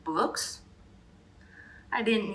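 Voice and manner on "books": confused. A narrator's reading. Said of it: "Braided Beard looked confused".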